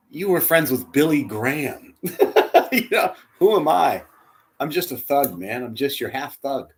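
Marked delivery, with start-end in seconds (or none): 2.0-2.7 high breathy laugh. Real, not acted. Laughs at his own joke.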